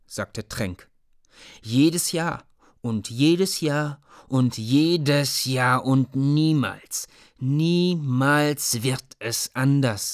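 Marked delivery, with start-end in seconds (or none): 1.6-10.1 very slow, higher, emphatic on niemals; slow heavy-hearted boy's protest; bitter lament. Acted. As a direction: drag out each jedes Jahr in bitterness.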